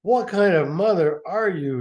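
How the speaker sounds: lightly voiced son scolding; angry brother. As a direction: confront the mother with reproach. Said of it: "he said".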